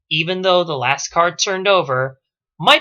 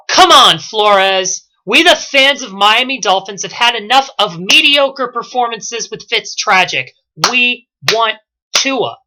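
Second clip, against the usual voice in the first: loud, higher fan outcry; fed-up fans' protest; 5 semitones higher, 8 dB louder than usual. Acted. Fans demanding a new quarterback from the coach, loud protest. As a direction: call it out like an exasperated crowd, dragging the first words. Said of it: "I know what you're thinking".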